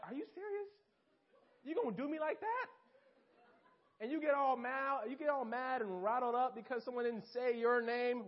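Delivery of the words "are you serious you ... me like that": indignant disbelief. Retold or imitated. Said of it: "you're sitting there like".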